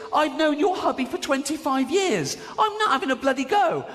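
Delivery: mock indignant protest. Acting out Louis arguing.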